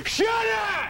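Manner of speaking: high, mad comic shout; exploding, hammed-up tantrum yell; Adam Sandler. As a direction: scream it in mock rage. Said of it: "where he's just really mad".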